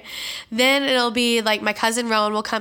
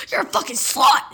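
higher, imitated outraged aunt; mock screaming insult; 5 semitones higher, 3 dB louder than usual. Triggered aunt insulting the teller's mom, mock angry. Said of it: "She's like".

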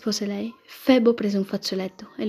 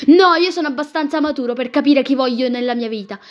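loud, raised retort; insistent; 3 semitones higher, 7 dB louder than usual. Acted. Nephew defends his choice to his aunt, insistent. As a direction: argue back firmly, starting high and loud.

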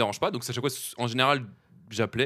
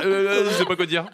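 tongue-tied, higher and louder; flustered and hesitant; 7 semitones higher, 6 dB louder than usual. Retold or imitated. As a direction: stammer like you're stuck for words. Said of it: "on serait tous en mode".

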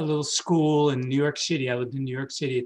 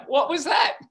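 high startled "what was that", half laughing; shocked disbelief; 12 semitones higher, slower than usual. Retold or imitated. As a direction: recall the jolt of hearing a voice. Shocked reaction to voice.

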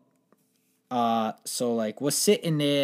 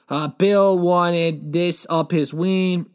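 slow explanation; 2 semitones higher, 5 dB louder, much slower than usual. Retold or imitated. Explaining to doctor.

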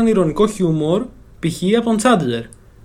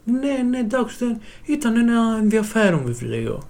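higher, softer imitation of a cornered classmate; mock a bluffer backing down softly; sheepish schoolmate; 5 semitones higher, 6 dB quieter than usual. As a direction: imitate someone hedging, quieter and lifted in pitch. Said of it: "Και ήταν αυτός".

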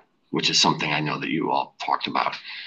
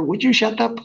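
annoyed shout; 5 dB louder than usual. Acted. Angry friend in car scene.

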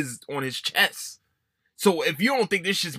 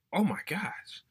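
whispered; 5 semitones higher, 8 dB quieter, slower than usual. Real, not acted. Whispered aside.

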